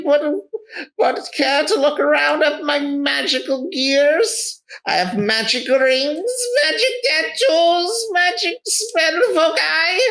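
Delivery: very high-pitched theatrical wizard; eccentric wizard merchant. Acted. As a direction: play a welcoming, showy wizard in a high voice. Wizard merchant lists wares.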